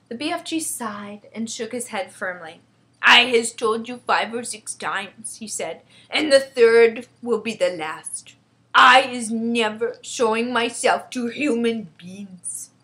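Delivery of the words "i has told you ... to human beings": slow, loud giant voice, firm refusal; emphatic giant putting his foot down; stubborn friendly giant. Acted. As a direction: lay it down heavily and slowly as a giant. BFG refuses Sophie.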